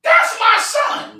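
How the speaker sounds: high, very slow, drawn-out plea; voicing a frantic mother; mother crying out. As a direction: stretch it out like a scared mom at the door. Mother calls from the door.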